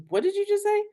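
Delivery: high, startled what-did-you-say; a mock shocked husband; husband's startled question. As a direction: imitate his sudden double take. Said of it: "He goes".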